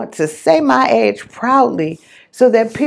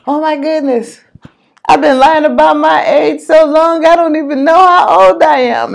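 retold uncle, a bit louder and quicker, half-laughing; 5 semitones higher, 6 dB louder than usual. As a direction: mimic his giggly confession, lightly. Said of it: "he was just laughing so hard".

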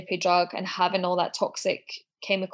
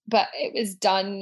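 ordinary delivery

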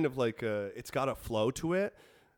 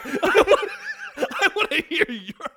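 laughing; 10 semitones higher, 5 dB louder than usual. Real, not acted. Laughing mock demand.

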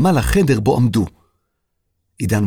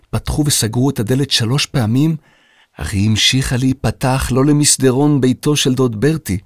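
plain reading voice

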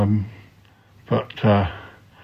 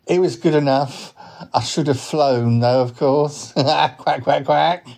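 slightly raised cartoon duck voice; talking duck; 4 semitones higher than usual. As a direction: play a duck politely declining. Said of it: "he is a duck".

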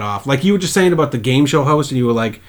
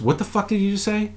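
higher, mock-outraged question; incredulous comeback; 8 semitones higher, 6 dB quieter than usual. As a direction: pretend indignation, half-voiced. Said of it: "he turns around and he goes".

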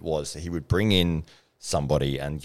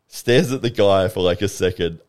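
normal talking voice

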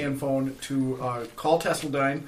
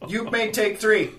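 loud, high robotic pronouncement; 8 semitones higher, 6 dB louder than usual. Acted. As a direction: deliver it like a stiff machine boss. Robo grants vacation.

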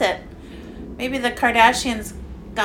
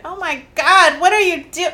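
alarmed gasp; 10 semitones higher, 6 dB louder than usual. Retold or imitated. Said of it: "you're like".

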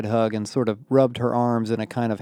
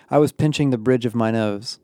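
ordinary delivery

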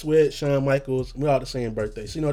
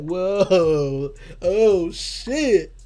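amazed reaction; 5 semitones higher, much slower than usual. Retold or imitated. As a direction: climb higher with each word in awe. Reacting to a lyric.

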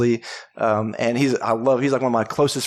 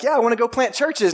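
put-on high, eager young convert voice; mock Paul, bursting with zeal; 10 semitones higher than usual. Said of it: "and he's like".

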